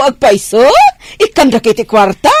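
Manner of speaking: ordinary talk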